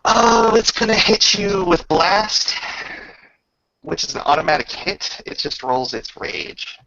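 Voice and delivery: usual speaking voice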